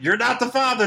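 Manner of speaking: talk show host. Retold or imitated. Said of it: "it's like".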